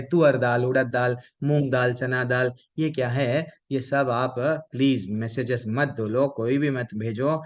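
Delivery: ordinary talk, nothing marked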